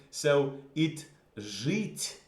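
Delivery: жить stretched out very slowly; slow model word. Shown as a demonstration. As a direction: draw out жить for the class.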